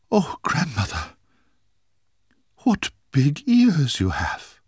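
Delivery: put-on a wide-eyed girl staring at big ears; little girl's wonder. Girl marvels at grandmother.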